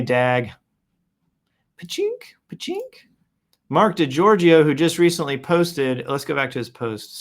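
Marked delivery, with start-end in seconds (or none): 1.8-3.1 squeaky high-pitched cash register noise; high pachink effect. Pretend. After praising Dag's video, a high squeaky pachink sound. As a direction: chirp it way up high like a ding.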